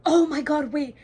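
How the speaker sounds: loud, high cry of shock; shocked shout. Real, not acted. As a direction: blurt it out stunned. Shock at a plot twist.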